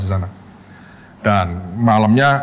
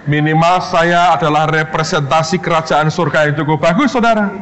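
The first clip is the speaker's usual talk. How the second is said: proud proclamation; 5 semitones higher than usual. Real, not acted. Preacher proclaims to the congregation.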